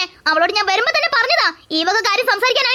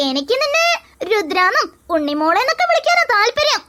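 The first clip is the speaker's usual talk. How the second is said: ordinary talk, nothing marked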